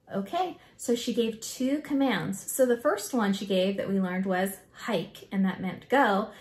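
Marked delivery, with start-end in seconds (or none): none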